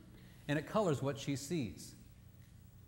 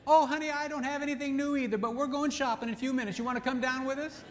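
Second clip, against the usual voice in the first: much higher, excited friend; eager girlfriends; 11 semitones higher, 3 dB louder than usual. Retold or imitated. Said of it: "ten women".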